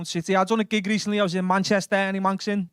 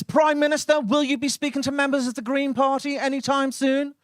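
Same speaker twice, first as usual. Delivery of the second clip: press reporter; 8 semitones higher than usual. Acted. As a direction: fire it at the PM like a hungry reporter. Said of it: "The national press".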